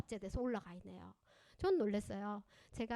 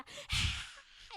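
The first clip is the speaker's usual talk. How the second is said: frustrated huff. Shown as a demonstration. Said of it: "이러면서 막 난리가 났어".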